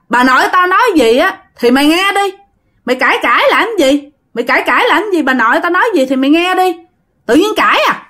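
high-pitched, quick telling-off; scolding. Acted. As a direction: scold a friend for arguing back. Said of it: "Hoàng nó mới nạt". (nạt bạn, giọng cao)

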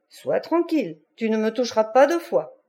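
usual speaking voice

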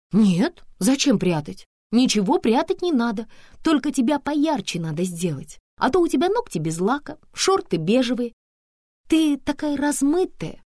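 high, emphatic, lively style adviser; opinionated Antonina, critical. Acted. Antonina criticising her friend's look, high and emphatic. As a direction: pitch it up and lay down the verdict.

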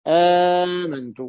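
آمنت slow, with a very long aa; lengthened vowel. Shown as a demonstration. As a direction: stretch the opening aa of آمنت. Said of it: "فصار ما لدينا مد". (آمنت بمدّ طويل)

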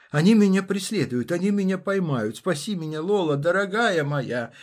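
high, loud frantic pleading; hysterical shouting. Acted. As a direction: scream it in panic through a door. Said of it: "Он истерически кричал".

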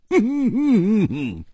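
high laughing whoop; high hearty laugh. Acted. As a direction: let out a bright laugh. Hu laughs after outburst. (高声大笑)

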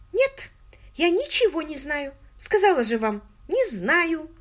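put-on high, eager girlish voice; an excited young woman confiding. A narrator's reading. Margot voiced by the narrator.

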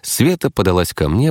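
ordinary delivery, nothing marked

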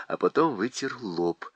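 plain reading voice

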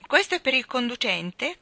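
mock the tiny tip with feigned surprise; sarcastic. A narrator's reading. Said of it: "commentò con sarcasmo". (con sarcasmo, a voce alta)

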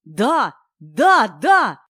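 loud, rising burst of yeses; overjoyed cry. A narrator's reading. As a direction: cry out, delighted at the luck. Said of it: "возопил Кирилл, обрадовавшись удаче".

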